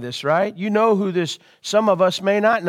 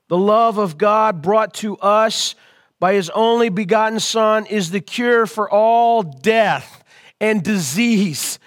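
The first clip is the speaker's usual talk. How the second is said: normal talking voice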